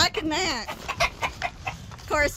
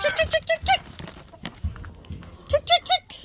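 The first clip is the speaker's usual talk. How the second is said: high sing-song chicken call. Real, not acted. Calling chickens to treats.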